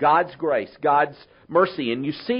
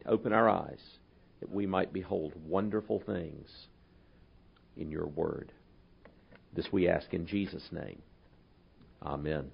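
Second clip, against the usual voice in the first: hushed, low prayer voice; quiet reverent prayer; 9 semitones lower, 8 dB quieter than usual. Acted. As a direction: pray it softly and reverently before the reading. Pastor leads a prayer.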